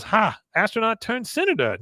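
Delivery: triumphant scoff. Retold or imitated. Con seizes on astronaut figure.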